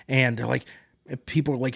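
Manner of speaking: ordinary delivery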